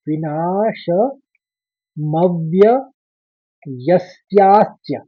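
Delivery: विनाशमव्ययस्यास्य drawn out very slowly, syllable by syllable; slow syllable recitation. Shown as a demonstration. Teacher spells out the long word very slowly. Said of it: "आप तीन आघात देख रहे हैं".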